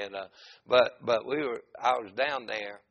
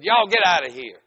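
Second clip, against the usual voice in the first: loud, raised-pitch holler; retold scream; 5 semitones higher, 11 dB louder than usual. Man ordering both preachers away, screamed. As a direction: shout them off the property. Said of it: "He screamed and said".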